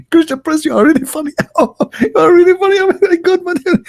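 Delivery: very high squealing voice breaking into laughs; imitating helpless laughter. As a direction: mimic someone cracking up mid-sentence. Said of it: "and he's laughing".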